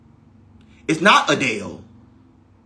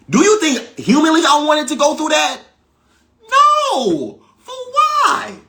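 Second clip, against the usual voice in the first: incredulous outburst; 8 semitones higher, 3 dB louder than usual. Real, not acted. Rhetorical outburst over near-death experiences.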